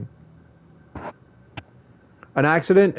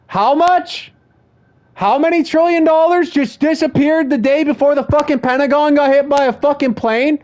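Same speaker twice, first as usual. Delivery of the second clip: raised pitch, fired-up furious rant; higher-pitched indignant tirade; angry rant; 7 semitones higher than usual. Real, not acted. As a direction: vent your outrage at them.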